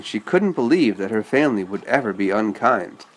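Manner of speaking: plain reading voice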